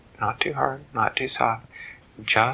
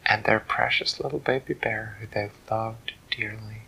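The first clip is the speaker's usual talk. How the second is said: ordinary delivery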